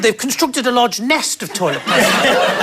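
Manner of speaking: ordinary talk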